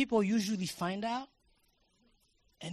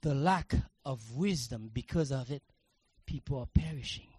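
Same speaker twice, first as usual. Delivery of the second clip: ordinary talk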